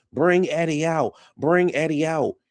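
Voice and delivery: high-pitched mock yell, drawn out; stretched, sing-out holler at modest volume; retold yelling. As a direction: imitate them calling for Eddie. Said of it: "they start yelling".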